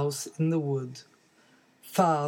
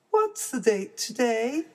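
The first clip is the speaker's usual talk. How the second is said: put-on baby bear voice; 7 semitones higher than usual.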